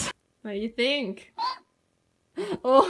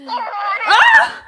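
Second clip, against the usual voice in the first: sudden high scream; jump-scared shriek; startled scream; 11 semitones higher, 11 dB louder than usual. Real, not acted. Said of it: "jump scared me".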